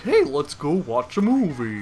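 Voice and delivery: lightly mocking, goofy voice with a pitch swoop; dopey friend impression. Friend suggesting an outing, in a mocking dopey voice. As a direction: imitate a friend talking like a dope.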